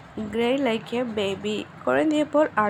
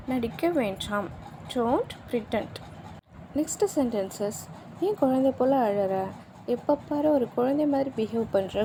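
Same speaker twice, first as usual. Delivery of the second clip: normal talking voice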